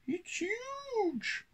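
very high, soft mouse squeak; Pippin gasping. A narrator's reading. Country mouse awed by the train, squeaky gasp. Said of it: "gasped Pippin".

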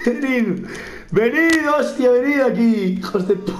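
goading enemies while cracking up; laughing taunt. Real, not acted. Gamer goading NPCs to attack, high laughing taunt.

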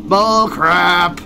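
lightly voiced cranky buddy; a hint of a cartoon grump; Cubert voice. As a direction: scoff it like the sidekick again. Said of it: "what Cubert would say".

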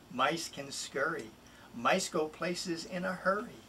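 ordinary delivery, nothing marked

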